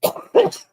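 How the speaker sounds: sharp, harsh real cough; real coughing fit. Real, not acted. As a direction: hack out a hard cough. Smoker coughing fit on stream.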